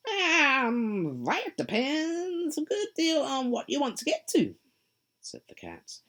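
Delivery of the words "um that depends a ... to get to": high, loud, sly grinning cat voice; a teasing cat, pleased with itself; Cheshire Cat. Acted. The cat answering lost Alice, high and sly.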